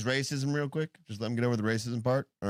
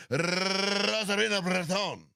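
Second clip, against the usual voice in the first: drawn-out, higher Spanish trill on the name; exaggerated Spanish accent; 5 semitones higher, slower than usual. Host teases co-host who dislikes it, exaggerated Spanish accent. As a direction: tease with an endless Spanish rolled R. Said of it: "You don't like that?".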